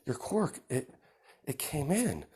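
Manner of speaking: put-on high, slow, awed boy's voice; amazed brother. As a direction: marvel at it, breathless and high. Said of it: "Midoriya's like".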